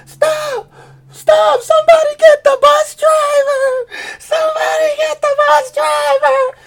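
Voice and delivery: high, loud screaming for help; mock panicked yelling. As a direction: shriek it like a beaten kid begging for rescue. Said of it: "Sausage yelling while he's getting hit".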